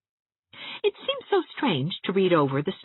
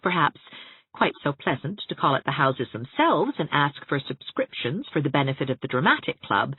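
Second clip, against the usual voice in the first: usual speaking voice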